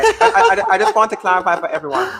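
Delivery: laughing through words. Real, not acted. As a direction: chuckle while talking. Laughing at caller.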